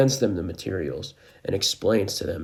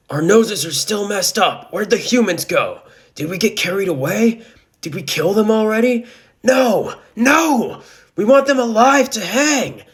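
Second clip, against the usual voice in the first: loud, high monster voice; disoriented monster; 13 semitones higher, 10 dB louder than usual. A narrator's reading. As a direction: rant as a confused beast, high and loud. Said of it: "a disoriented Eldritch Horror Chimera monstrosity says".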